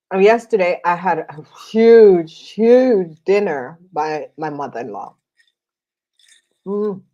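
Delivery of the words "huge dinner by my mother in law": ordinary delivery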